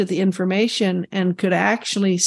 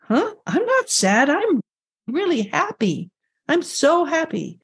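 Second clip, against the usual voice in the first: startled, much higher protest; reliving a baffled reaction; surprised denial; 7 semitones higher than usual. Retold or imitated. Patient answers the chiropractor who senses sadness, in startled protest. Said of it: "And I went".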